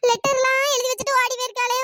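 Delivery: ordinary talk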